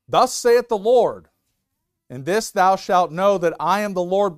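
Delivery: booming King James reading; biblical proclamation. Acted. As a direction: proclaim it like a prophet. Proclaims scripture aloud.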